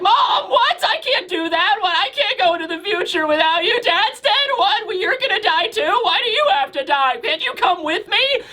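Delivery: very high, hammed-up tearful wail; mock child crying. Eight-year-old begging his mother not to die, mock crying. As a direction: blubber in protest like a small child. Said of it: "Momonosuke begins to cry".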